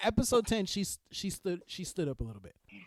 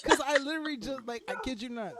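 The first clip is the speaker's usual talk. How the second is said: usual speaking voice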